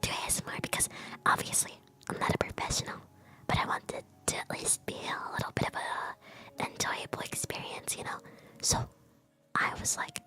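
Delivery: soft ASMR whisper close to the mic. Real, not acted. Streamer trying out ASMR for viewers, whispering. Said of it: "if I'm whispering really close to the mic".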